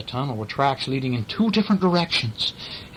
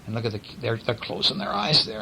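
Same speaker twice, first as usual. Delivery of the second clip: usual speaking voice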